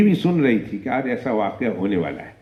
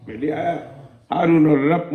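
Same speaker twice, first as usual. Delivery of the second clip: ordinary talk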